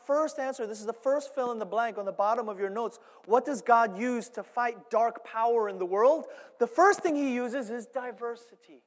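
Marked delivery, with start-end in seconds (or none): none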